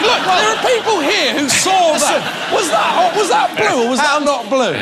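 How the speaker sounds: indignant shouting. Acted. Arguing the vote result.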